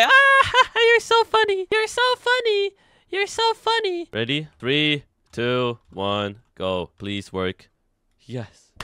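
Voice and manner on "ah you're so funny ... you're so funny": squealing, extremely high fake-laughing mockery; mock them in a piercing falsetto; mock amusement. Player mocking the troll who attacked him, squealed sarcastic falsetto.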